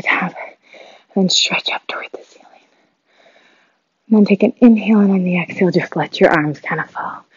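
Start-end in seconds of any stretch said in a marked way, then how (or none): 1.1-2.5 whispered, soft and fast. Real, not acted. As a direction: breathe the cue out in a whisper. Cool-down stretch cue.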